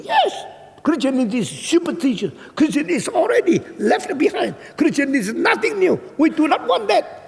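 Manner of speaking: high, heated tirade; fierce woman. Retold or imitated. Fierce Dutch woman scorns Christianity, high angry tirade. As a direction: rant in a high voice, hammering each claim. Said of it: "perempuan galak seperti itu".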